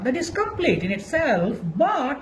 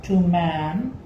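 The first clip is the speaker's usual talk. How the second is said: slow, low, deliberate recitation with long pauses; measured classroom quotation; slow dictation; 5 semitones lower, 5 dB quieter, much slower than usual. Shown as a demonstration. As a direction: dictate the epigram word by word. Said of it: "let us take an example of epigram".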